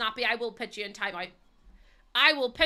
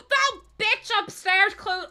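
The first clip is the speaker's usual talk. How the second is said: high, slow, shocked gasp of outrage at the neighbour; slow high gasp, scandalised; 11 semitones higher, 6 dB louder, slower than usual. Real, not acted. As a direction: choke out the "bitch upstairs" line in disbelief.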